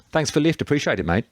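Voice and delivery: put-on Birmingham accent. A narrator's reading. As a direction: say thanks in a Brummie accent. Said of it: "His accent immediately identified him as one of the refugees from Birmingham".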